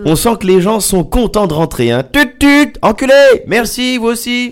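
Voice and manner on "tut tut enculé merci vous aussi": high-pitched mock traffic shouting; acted honking driver. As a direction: yell it like a cheery road-rage driver. Acts out honking drivers back in town.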